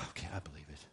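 low, soft, acted defeat after a sigh; mock-defeated surrender, sunk low; resigned giving in. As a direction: give in, dropping pitch and volume. Gives in after being called jerk.

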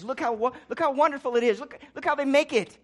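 loud, mock-admiring look-at-them; mocking proclamation. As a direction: sell it like a cheap advert for the wicked. Mocking the culture's message.

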